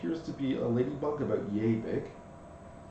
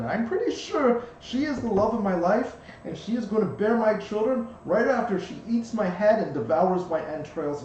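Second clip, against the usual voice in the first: high, louder tiny bug voice; pixie; 9 semitones higher, 6 dB louder than usual. Acted. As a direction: gush about his lovesick doom, squeaky. GM voices the ladybug.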